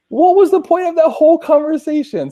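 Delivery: high, loud disbelieving retort; retold outburst at the rep who took back his deal, high and loud; exasperated outburst. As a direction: throw the question back in disbelief. Exasperated at reversed promise.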